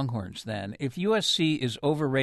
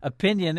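"Opinion" set apart, higher and firmer; stress on OPINION; 4 semitones higher, 4 dB louder than usual. Real, not acted. Host warns the Trojans fans in L A, stressing "Opinion". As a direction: lean on the word "Opinion". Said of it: "and I stress that word".

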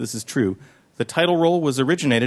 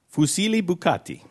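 put-on Fusilli bucati with a rolled Italian accent; 3 semitones higher than usual. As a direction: read the pasta name like a proud Italian. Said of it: "Your Italian accent is phenomenal".

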